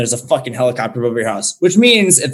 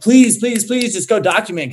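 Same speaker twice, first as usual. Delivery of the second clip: retold pleading; 7 semitones higher than usual. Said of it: "so many people are like".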